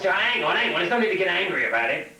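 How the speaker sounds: hammed-up wounded objection; aggrieved protest. Beaten lion protests to elephant.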